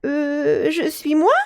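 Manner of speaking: put-on a child caught off guard; hesitant boy. Jacques hiding his name from the fairy, hesitant.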